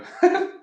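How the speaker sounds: high burst of laughter; detective laughing. Pretend. A detective laughs out loud at a crime scene. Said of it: "And I go".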